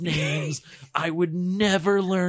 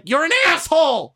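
retorting shout; 8 semitones higher, 5 dB louder than usual. Retold or imitated. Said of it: "I'm like".

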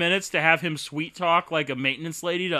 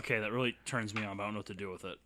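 much softer, low confession; quiet; 6 semitones lower, 13 dB quieter, faster than usual. Pretend. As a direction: murmur it softly. Hushed confession.

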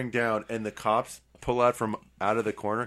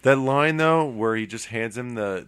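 ordinary talk, nothing marked

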